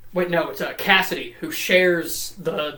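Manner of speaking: usual speaking voice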